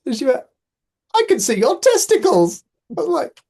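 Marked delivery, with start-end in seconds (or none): 1.1-2.6 high, loud posh announcement; posh customer. Retold or imitated. Posh customer to tree surgeon in split trousers, high mock-posh. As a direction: announce it as a scandalised posh client. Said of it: "this very posh customer".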